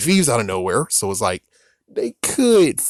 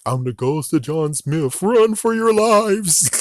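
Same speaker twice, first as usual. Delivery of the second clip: spooky ghost voice; 5 semitones higher, slower than usual. Retold or imitated. Voicing the ghost of John Smith.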